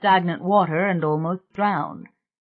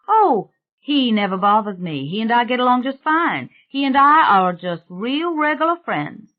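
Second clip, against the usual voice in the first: higher-pitched, easygoing gardener voice; untroubled gardener; 7 semitones higher, 3 dB louder than usual. Acted. Gardener talks of his ghostly friend, untroubled.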